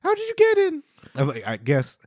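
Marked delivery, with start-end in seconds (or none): 0.0-0.8 very high, squeaky mock kid voice; terrified little boy. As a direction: squeal it like a scared little brother. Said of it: "He's like".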